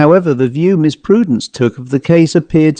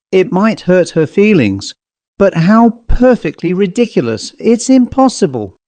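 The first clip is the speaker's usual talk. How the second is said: higher-pitched, flustered exclamation; flustered protest; 6 semitones higher than usual. A narrator's reading. Miss Boggs correcting herself after a blush, flustered.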